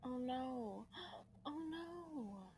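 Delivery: quiet, high, choked-up oh no; tearful gasp. Real, not acted. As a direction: gasp and whimper in sorrow. Sad moment for the shark.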